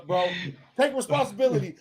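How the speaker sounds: ordinary delivery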